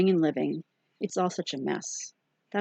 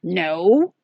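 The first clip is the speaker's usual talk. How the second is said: put-on a sudden loud firm no from a small child; lightly voiced earnest little girl; serious little kid; 10 dB louder than usual. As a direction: blurt it like a solemn seven-year-old. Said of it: "in that serious little kid kind of way".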